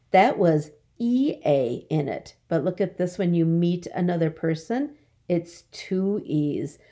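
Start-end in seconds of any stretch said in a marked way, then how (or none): none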